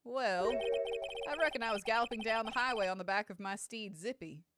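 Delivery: folksy cowboy storytelling; cowboy security officer. Acted. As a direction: reckon it out like an old-West lawman.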